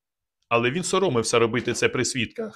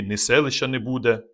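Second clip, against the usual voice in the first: ordinary delivery, nothing marked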